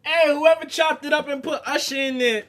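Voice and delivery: high, shouted disbelief; loud incredulous outburst. Real, not acted. Host reacting to an outrageous clip, loud incredulous outburst.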